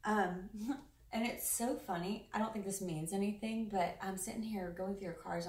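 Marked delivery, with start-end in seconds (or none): none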